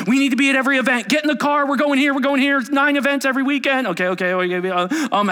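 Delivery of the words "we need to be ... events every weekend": much higher, louder and fast orders; hammed-up overachiever rushing everyone; frantic go-getter. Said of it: "how about achiever people?".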